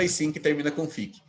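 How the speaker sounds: ordinary talk, nothing marked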